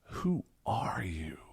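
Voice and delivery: slow awed whisper of Who are you. Shown as a demonstration. Teacher showing a shaken disbelief, whispered. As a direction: breathe it out slowly, aghast. Teacher demos existential question.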